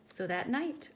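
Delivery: plain reading voice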